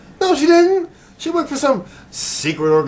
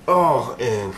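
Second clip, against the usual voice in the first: normal talking voice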